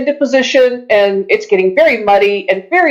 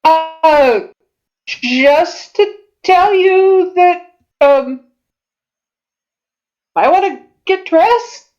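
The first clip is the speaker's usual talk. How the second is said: innkeeper voice; 6 semitones higher, slower than usual. Acted. GM plays the innkeeper.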